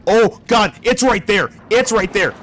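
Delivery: loud panicked yelp; being chased. Real, not acted. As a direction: cry out as it closes in. Monster appears, chased.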